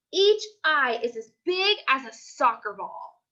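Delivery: ordinary delivery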